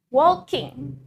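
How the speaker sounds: walking with its full -ing ending; walking as WALL-king, standard ending. Shown as a demonstration. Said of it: "Walking ada huruf G di akhir".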